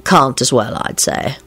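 low, understated reply; scholarly guest. A narrator's reading. Lupin answering Radu on the poet's influences, understated.

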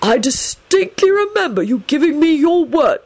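high, reproachful reminder; reproving. A narrator's reading. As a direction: remind him of a broken promise. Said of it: "Dumbledore said reprovingly".

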